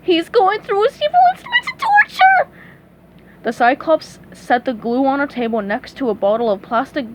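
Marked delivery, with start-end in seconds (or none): 0.0-2.5 very high, loud cartoon panic; panicked yell. A narrator's reading. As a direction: shriek a terrified warning. Trembling hero fears the giant.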